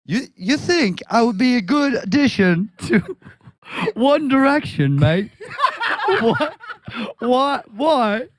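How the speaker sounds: put-on best British accent. Asking to join One Direction, mock British accent. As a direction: put on British, breaking into giggles. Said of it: "in his best British accent".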